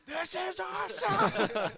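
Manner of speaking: high, loud, slow screaming fan; excited fan yell. Pretend. Pretend fan screaming in crowd.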